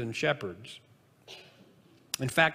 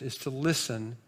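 ordinary talk, nothing marked

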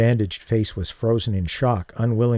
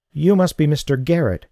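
higher, louder, a cool challenge from the mother; stern mother confronting the man, challenging; 6 semitones higher, 4 dB louder than usual. A narrator's reading. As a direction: meet him with a cold challenge. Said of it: "she challenged".

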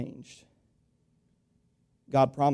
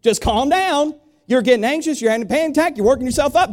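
telling you calm down; 11 semitones higher, 6 dB louder than usual. Retold or imitated. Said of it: "You ever had somebody tell you that?".

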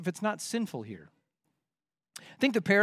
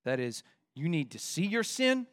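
ordinary talk

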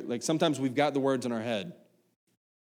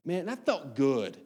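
relieved; slower than usual. Retold or imitated. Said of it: "I was like".

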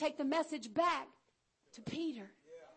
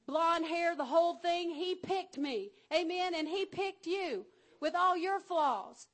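louder, higher, building preaching; full-force preaching; 4 semitones higher, 6 dB louder than usual. Real, not acted. Preacher rousing the congregation about being chosen, louder fervent preaching. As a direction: preach it out with fire.

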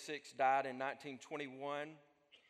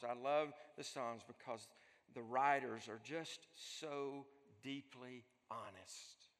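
normal talking voice

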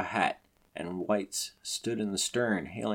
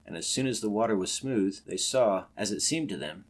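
plain reading voice

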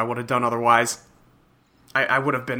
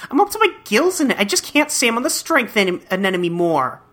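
put-on higher, snappy scolding troll girl; exasperated fish troll girl; 9 semitones higher than usual. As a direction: scold him for the drama.

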